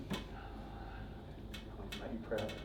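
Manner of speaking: barely voiced, slow, tender whisper to Dad; whispered promise. Real, not acted. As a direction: breathe it out slowly, almost silent. Son's farewell to late father.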